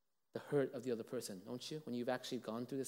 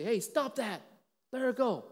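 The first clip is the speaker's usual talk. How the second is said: higher, louder mock yell from a window; neighbor's shout; 9 semitones higher, 7 dB louder than usual. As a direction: call out to scare off the attacker. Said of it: "you hear one voice say".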